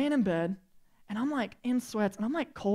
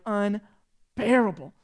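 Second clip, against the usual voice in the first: normal talking voice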